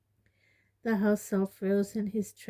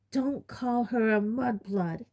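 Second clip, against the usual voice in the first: a sharp, angry rebuke, higher; 4 semitones higher than usual. A narrator's reading. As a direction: snap at the elf in outrage. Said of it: "said Ron and Ginny together very angrily".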